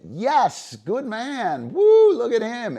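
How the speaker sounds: very high, loud, gushing praise; delighted praise. Shown as a demonstration. Handler celebrating the dog's catch, very high and loud. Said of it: "And when he gets it".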